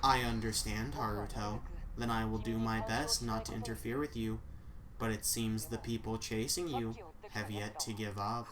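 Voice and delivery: low, soft, even assistant voice; AI secretary voice. Acted.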